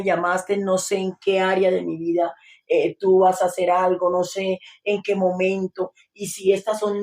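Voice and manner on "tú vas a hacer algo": ordinary delivery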